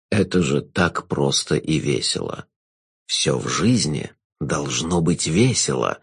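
low, slow, velvety jester; sinister jester. Acted.

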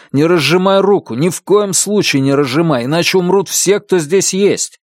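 earnest, insistent instruction; urgent warning. A narrator's reading. Policeman urgently warns boy about grenade.

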